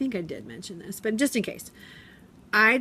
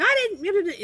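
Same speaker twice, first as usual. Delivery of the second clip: put-on kid denying; 13 semitones higher, 5 dB louder than usual. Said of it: "the other kid's like".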